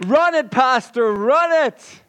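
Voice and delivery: high, loud cheer for the pastor; cheering crowd. Acted. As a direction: cheer him on like a fired-up congregation. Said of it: "And the crowd cheers".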